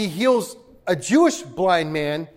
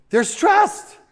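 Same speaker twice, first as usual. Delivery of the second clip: TRUST lifted much higher; rising exclamation; 13 semitones higher than usual. Real, not acted. Pastor repeating his refrain, pitched up high. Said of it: "refrain repeated".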